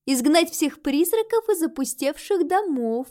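high-pitched, condescending sweetness; gracious repeat. A narrator's reading. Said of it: "милостиво повторила Рози".

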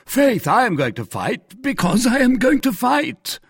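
high, loud, blustering retort; flustered bluster. A narrator's reading. Porthos, reddening, has no reason for his duel, flustered. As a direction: voice jumps up, loud and indignant. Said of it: "answered Porthos, reddening".

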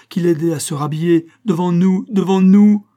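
high, loud, mounting excitement; hilarious disbelief. Acted. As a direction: build to a loud, high peak of glee. Gleeful retelling rises to a peak. (surexcitée, de plus en plus fort)

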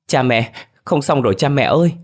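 high, loud frantic call for her parents; panicked cry. A narrator's reading. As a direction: burst in calling out in alarm. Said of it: "tiếng hớt hải của một cô gái".

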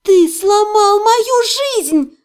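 high, loud, drawn-out wail; anguished high-pitched yell. A narrator's reading. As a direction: cry it out at full pitch. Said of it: "завопила я".

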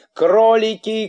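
высокий, громкий крик-призыв; зови во весь голос, высоко; истошный вопль. A narrator's reading. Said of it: "вопил из его живота". (вопит, созывая кроликов)

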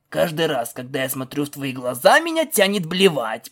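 plain reading voice

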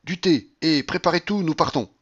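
high, loud household command; crying out orders. A narrator's reading. Babouchka to servants on entering, crying out. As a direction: order everyone about urgently. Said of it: "elle cria".

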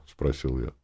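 usual speaking voice

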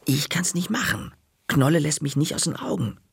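high, urgent whisper; hushed, high-pitched excuse; whispered protest. A narrator's reading. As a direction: whisper it anxiously so nobody overhears.

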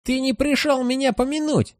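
high hissing ghoul voice. Acted. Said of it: "шипит Гуль".

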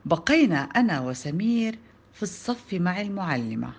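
ordinary delivery

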